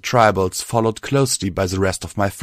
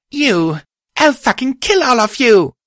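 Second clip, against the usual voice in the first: much higher, louder enraged yell; furious scream; 6 dB louder than usual. Acted. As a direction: scream with blind rage. Rage after friend is killed.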